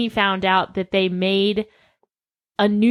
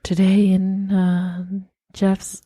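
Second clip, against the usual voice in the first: mock NPR anchor; slower than usual. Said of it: "NPR parody".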